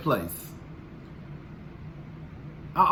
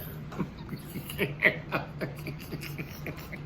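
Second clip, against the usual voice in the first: breathy laugh; 11 dB quieter than usual. Real, not acted. Laugh after the mock voice.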